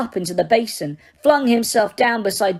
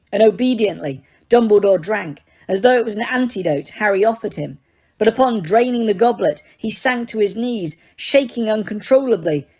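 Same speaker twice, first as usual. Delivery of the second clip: ordinary delivery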